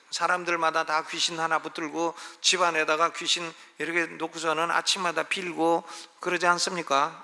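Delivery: ordinary talk, nothing marked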